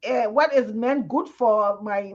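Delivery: ordinary talk, nothing marked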